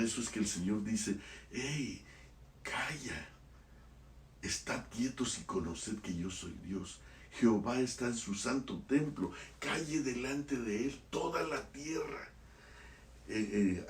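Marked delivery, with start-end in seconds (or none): 1.5-12.3 softly quoting God's call to stillness; hushed. Acted. Preacher quoting the Lord to his listeners, hushed. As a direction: hush the congregation, almost under the breath. God telling people to hush.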